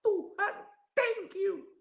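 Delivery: very high, strained cry of thanks; retold tearful cry. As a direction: cry out high to God, without extra volume. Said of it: "Saya nangis, saya berteriak". (seruan syukur bernada tinggi, seperti menangis)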